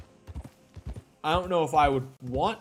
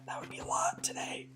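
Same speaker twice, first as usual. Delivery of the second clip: soft whispered aside; whispering; 7 dB quieter than usual. Real, not acted. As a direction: say it under your breath. Muttered aside after topic remark.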